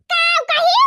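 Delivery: high sharp yell of disbelief; outraged family-quarrel shout; shouted retort. Acted. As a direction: fling it back loud and high.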